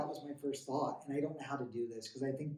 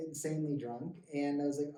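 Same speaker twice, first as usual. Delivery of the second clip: normal talking voice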